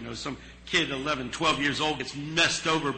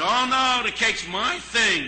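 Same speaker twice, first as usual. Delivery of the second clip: eager protest; 9 semitones higher, 8 dB louder, slower than usual. Retold or imitated. Harry eagerly claims the cake.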